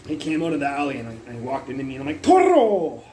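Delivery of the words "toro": sudden high, loud shout; retold yell. Man walked into him from the alley, so he yells. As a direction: burst out with a bullfight holler. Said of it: "I was yelling, "Toro, Toro".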